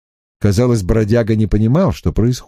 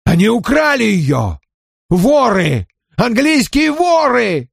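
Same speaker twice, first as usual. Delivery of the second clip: high furious outcry, building; furious shout; 11 semitones higher than usual. Acted. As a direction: rage at the English thieves. Spirit furious at thieves.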